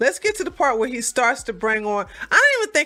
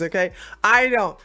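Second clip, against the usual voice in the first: normal talking voice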